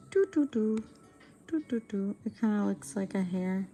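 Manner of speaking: usual speaking voice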